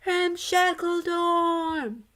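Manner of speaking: high, loud and slow ghostly cheer. A narrator's reading. Ghost cheering the new dorm name, high and drawn out. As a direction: stretch it out triumphantly.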